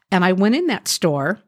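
usual speaking voice

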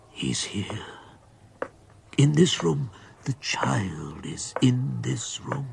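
slow fearful whisper; don't let it hear you; terrified whisper. Acted. Grandfather whispering about ghost.

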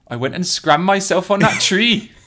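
high, hammed-up Welsh accent; a mild Welsh accent. Shown as a demonstration. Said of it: "you're funny with accents".

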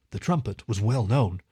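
ordinary delivery, nothing marked

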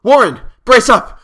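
loud, high rallying shout; resolute shout. A narrator's reading. Narrator shouting down to his trapped friend, resolute. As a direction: shout down to him with determination. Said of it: "I formed and shouted a resolution".

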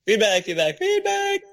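echo effect. Pretend. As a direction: echo the word like feedback. Echoing the word feedback.

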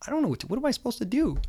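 high, fast, helpless babble; imitating his own panicked self; flustered panic. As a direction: rattle it off like a cornered man. Cornered by cameras, panicking.

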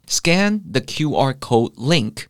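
slow, careful English example; slow demo. Shown as a demonstration. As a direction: say each word slowly for learners. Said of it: "到底要怎么用英文表达呢". (放慢速度示范英文句子)

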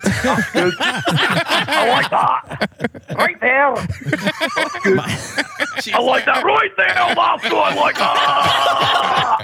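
high, drawn-out Scottish cries of pleasure; thick Scottish accent. Acted. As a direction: wail it high in hammed-up Scottish, stretching the endings. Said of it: "with that thick Scottish accent".

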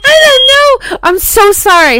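loud, high-pitched frantic apology; real panicked shouting over an alarm; flustered yell. Real, not acted. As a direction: cry out, then settle toward normal. Flustered by smoke alarm, yelling.

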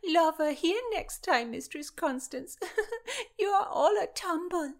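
high, loud teasing through giggles; giggling. A narrator's reading. Christina teasing Constance about a lover, giggling. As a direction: tease with laughter bubbling between phrases.

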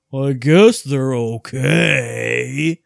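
very slow, drawn-out reluctance; grudging okay. Acted. Game character answering about red pants, grudging drawn-out okay. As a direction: drag out every word with a sighing reluctance. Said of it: "he goes".

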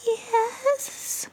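tiny high whisper; small voice. Acted. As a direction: barely dare to answer. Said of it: "whispered Gilbert in a small voice".

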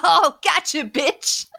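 loud gleeful gotcha; crowing with a laugh; triumphant gotcha. Real, not acted. Catching friend out, teasing.

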